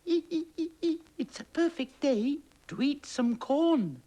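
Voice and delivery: very high, loud squeaky mouse voice. Acted. Mouse after the corn, very high squeaky voice. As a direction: squeak the words like a tiny mouse. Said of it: "thought the mouse".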